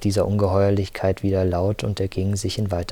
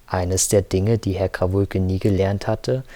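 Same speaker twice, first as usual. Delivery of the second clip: usual speaking voice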